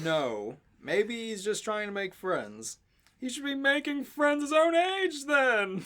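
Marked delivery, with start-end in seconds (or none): 3.2-5.8 put-on higher, whining teen girl; whiny. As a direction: whine it like a teenager. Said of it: "Sam whines".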